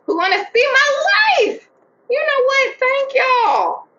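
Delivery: high, loud, thrilled thanks; excited. Real, not acted. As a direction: gush with joy to fans. Celebrating 500 subscribers.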